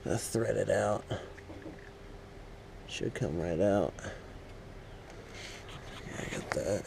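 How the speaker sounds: strained voice, lying on your back; lying under a car. Real, not acted. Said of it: "now I'm under the car".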